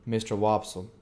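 ordinary delivery

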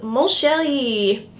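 slow, hammed-up French accent; terrible French accent. Reading a jumper slogan to viewers in a mock French accent. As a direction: drawl it out in a bad French accent. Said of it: "That was a terrible French accent".

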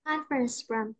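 Conference with s before room; final S sound. Shown as a demonstration. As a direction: softly model Conference room with the s. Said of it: "C E là chữ S đó".

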